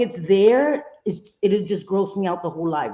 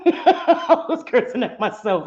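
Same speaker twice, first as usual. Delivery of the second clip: slow, laughing protest; 5 semitones higher, 3 dB louder than usual. Real, not acted. Streamer defending herself to viewers, laughing. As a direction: protest through real laughter.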